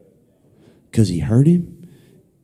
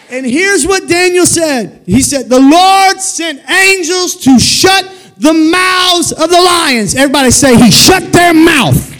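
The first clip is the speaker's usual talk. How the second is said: high, loud preacher's shout; full-force preaching; 8 dB louder than usual. Real, not acted. Preacher telling how angels shut lions' mouths, shouted preaching. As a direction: belt it out to rouse the congregation, drawing out the key words.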